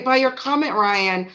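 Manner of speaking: ordinary talk, nothing marked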